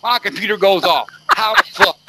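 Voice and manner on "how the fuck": much higher, louder incredulous cry; exasperated outburst. Real, not acted. Ranting to co-hosts about the computer shutting off, outraged high yell. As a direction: blow up in disbelief at the shutdown.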